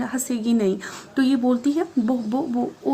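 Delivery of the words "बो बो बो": stuck repeating the first sound, lightly acted; a shy girl stammering over her own name. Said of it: "फिर से stammer करने लगी".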